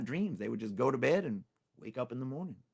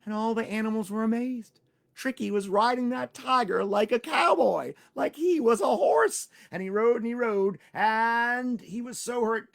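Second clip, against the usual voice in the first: excited narration; 9 semitones higher, 7 dB louder than usual. A narrator's reading. Climax of the ride.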